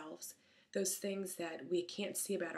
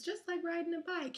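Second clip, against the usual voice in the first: mock sing-song quote; 11 semitones higher, 3 dB louder than usual. Same phrase heard as trauma.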